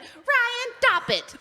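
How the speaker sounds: teasing high mock scolding; mocking mimicry of wife. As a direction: parrot the wife in a squeaky voice. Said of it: "And he went".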